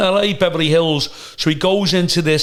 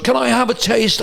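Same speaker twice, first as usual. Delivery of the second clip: posh comic host impression; 9 semitones higher than usual. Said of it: "And he goes".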